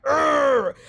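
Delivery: playful exasperated grunt; mock frustrated groan. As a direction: let out a mock fed-up urgh. Frustration she says she didn't have.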